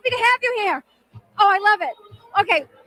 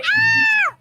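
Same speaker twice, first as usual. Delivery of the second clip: excited squeal; 13 semitones higher than usual. Real, not acted. Host squeals at flirt.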